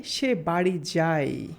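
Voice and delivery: "সে বাড়ি যায়" said slowly as one phrase; slow teaching example read as one phrase; slow whole sentence. Shown as a demonstration. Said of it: "যদি আমরা এই কথাটুকু বলি".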